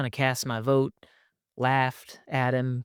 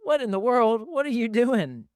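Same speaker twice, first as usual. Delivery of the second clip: dad teasing his son, higher laughing scold; mock dad scolding; 11 semitones higher than usual. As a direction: scold playfully through a laugh. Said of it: "F-, like".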